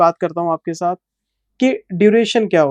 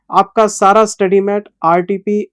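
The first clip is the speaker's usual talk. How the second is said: usual speaking voice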